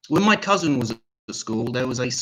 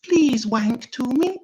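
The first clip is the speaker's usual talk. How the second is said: sad weedy little voice; 8 semitones higher, 4 dB louder than usual. Retold or imitated. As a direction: beg like a pathetic little woman. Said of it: "her sad, weedy little voice".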